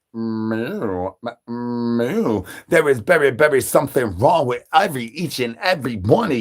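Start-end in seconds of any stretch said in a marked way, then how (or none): 0.1-2.4 lower, softer cow moo; a lightly voiced bull mooing; mock cow moo. Bull answers the call.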